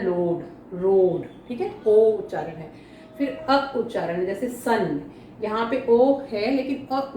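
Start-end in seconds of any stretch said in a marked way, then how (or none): 0.0-1.5 "लोड, रोड" with a long, slow, drawn-out o. Shown as a demonstration. Teacher modelling the o sound for students, slowly. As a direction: stretch the o in "लोड" slowly for the class. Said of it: "इसको ओ बोला है".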